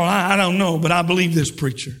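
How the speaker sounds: ordinary delivery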